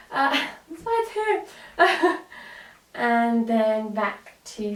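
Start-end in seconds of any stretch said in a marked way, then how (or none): 0.1-2.3 laughing. Real, not acted. Laughs after near fall.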